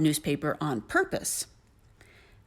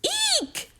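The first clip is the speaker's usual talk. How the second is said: very high-pitched storybook "eek" scream. A narrator's reading. A startled woman leaps out of bed, high scream. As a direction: squeal a short, high eek. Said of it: "she screamed".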